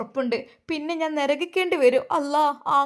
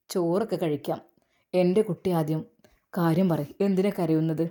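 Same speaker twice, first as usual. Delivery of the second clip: tender husband; 7 semitones lower than usual. Acted. Husband gently asks why she cries.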